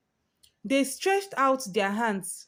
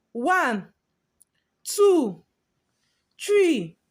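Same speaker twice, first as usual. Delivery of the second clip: louder, higher count, each number called out; counting down loudly; 4 semitones higher, 5 dB louder, much slower than usual. Acted. Senior counting down at the hostel girls, calling out. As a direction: bark the numbers at the whole hostel.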